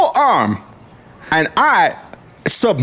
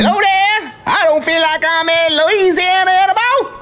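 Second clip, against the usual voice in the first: put-on overexcited Louisiana accent; 7 semitones higher than usual. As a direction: let the Louisiana twang run wild. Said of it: "I get a little too crazy".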